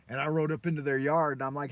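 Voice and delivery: ordinary talk, nothing marked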